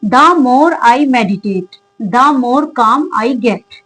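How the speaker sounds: very slow, each word spaced out; teacher reading an English example slowly. Shown as a demonstration. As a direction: read the model sentence slowly for students. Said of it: "next sentence देखिए".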